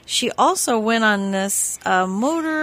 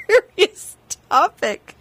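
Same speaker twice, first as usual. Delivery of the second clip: high, slow, squeezed by laughter; real giggles; 9 semitones higher, 5 dB louder, slower than usual. Real, not acted. Host trying to steer back to weight loss, giggling. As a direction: try to stay serious while cracking up. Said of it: "we now both have the giggles".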